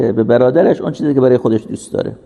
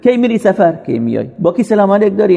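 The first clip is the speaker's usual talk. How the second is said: lightly mimicked busybody quizzing, mostly higher-pitched; prying questions; 6 semitones higher than usual. Preacher imitating a busybody prying into someone's travels, nosy questioning. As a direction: imitate someone nosily collecting details.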